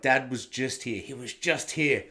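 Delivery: ordinary talk, nothing marked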